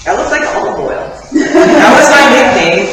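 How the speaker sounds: giggling self-mocking confession; laughing. Real, not acted. Laughs at childhood nickname.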